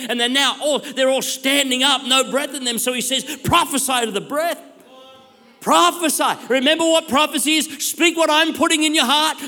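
high, loud prophetic proclamation; full-force preaching. Real, not acted. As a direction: preach the vision with urgent, breathless wonder.

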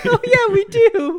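high, loud, helpless laughter through the words; real squealing laughter; laughing. Real, not acted. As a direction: crack up while agreeing. Cracking up at the sound effect.

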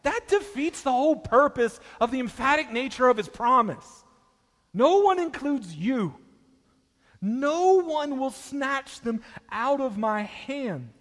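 high, loud ringing declaration; emphatic preaching. Acted. Preacher correcting misreaders of the verse, ringing. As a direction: drive the promise home.

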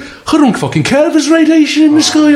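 hammed-up Brummy outburst, swearing; mock Brummy accent. Impersonating the fat actor's Brummy accent in Chernobyl. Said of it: "his Brummy accent or whatever".

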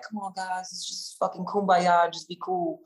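imitating an easygoing European peacemaker; slow, stretched plea to calm everyone down; Vlad. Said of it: "typical European fashion, Vlad was just like".

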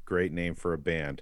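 low, soft sarcastic aside; deadpan sarcasm. Real, not acted. Co-host mocking the band's name, deadpan sarcasm. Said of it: "hear the sarcasm in my voice".

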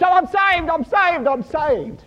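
high, loud, hammed-up elation; overjoyed rescued man. Rescued swimmer jumping up, high excited shouting.

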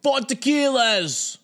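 high, drawn-out imitation of a bar yell; mock yelling. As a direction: holler it slowly and high, mocking the customer. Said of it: "He kept yelling".

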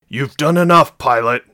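loud, high angry outburst; snapped. A narrator's reading. Sergeant to pilot blocking his exit, snapping angrily. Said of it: "he snapped at her".

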